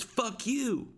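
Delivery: high put-on girl voice spitting it out; mock girl storming off. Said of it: "She goes".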